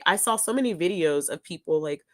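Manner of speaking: ordinary talk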